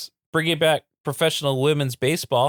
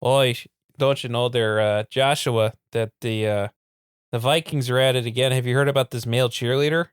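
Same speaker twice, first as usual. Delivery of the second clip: put-on chatty Minnesotan gossiping in a thick accent; folksy Minnesota accent, rounded vowels; deep Minnesota accent; 2 semitones lower than usual. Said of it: "like, a deep Minnesota accent".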